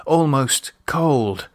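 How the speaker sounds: plain reading voice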